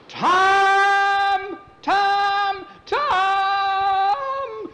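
long, high, panicked scream of the name; drawn-out falling shriek; screaming for help. Acted. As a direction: scream each call as if plummeting. Falling boy screams for brother.

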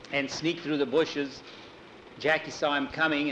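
plain reading voice

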